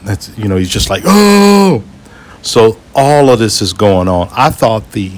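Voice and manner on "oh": high-pitched "oh" yelp; mock scream. Man in front freaking out in turbulence, imitated screaming. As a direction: imitate a man's scream, much higher than usual. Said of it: "he's just literally just screaming".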